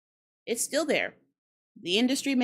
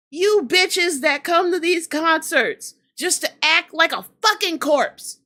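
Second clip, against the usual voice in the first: angry rant. Real, not acted. Vlogger scolding bored concertgoers, angry yelling. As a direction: keep yelling at them. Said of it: "I'm pissed".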